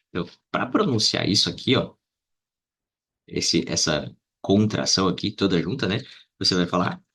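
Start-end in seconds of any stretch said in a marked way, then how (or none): none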